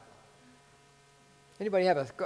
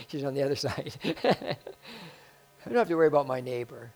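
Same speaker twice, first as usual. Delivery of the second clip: laughing. Real, not acted. Laughing aside about neighbor.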